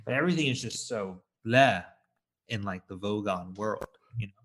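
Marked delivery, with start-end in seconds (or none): none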